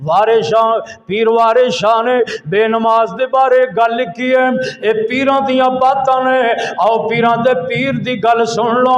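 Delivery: loud, high, driving sermon narration; fiery preaching. Acted. Preacher retelling the Prophet's vision, fiery preaching. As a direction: tell it forcefully, phrase after phrase.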